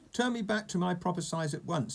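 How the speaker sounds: high, mildly enraged demand; spluttering rage. A narrator's reading. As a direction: splutter it out indignantly. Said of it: "spluttered Mister Uppity in a rage".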